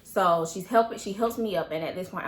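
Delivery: ordinary talk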